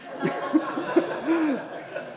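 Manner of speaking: a quick airy laugh at himself; high breathless laugh. Real, not acted. Pastor laughing with the congregation at his mistake, breathy laugh. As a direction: laugh off the embarrassing slip.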